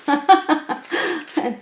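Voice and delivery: quick amused laugh; a little embarrassed laugh; short laugh. Real, not acted. Apologising for crunching noises.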